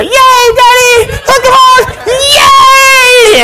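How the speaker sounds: very high, loud, drawn-out cheer; hammed-up little girl's shriek of joy; screaming excited child. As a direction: squeal for Daddy like a cheering kid. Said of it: "and you're screaming, and you're excited".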